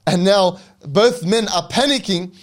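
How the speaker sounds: normal talking voice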